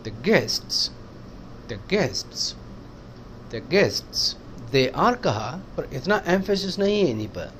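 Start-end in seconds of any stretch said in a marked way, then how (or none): 0.0-4.4 guests stressed and lower, The kept light. Shown as a demonstration. Teacher drilling stress on the noun guests. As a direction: lean on guests each time. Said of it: "guests पर है".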